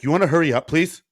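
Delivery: put-on high, loud impatient snap at the sandwich girls; snapping. Rude customer snapping at the sandwich girls, loud and high. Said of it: "just snapping for no reason".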